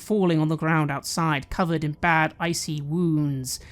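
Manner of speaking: ordinary talk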